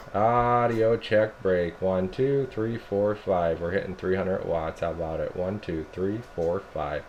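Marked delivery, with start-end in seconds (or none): none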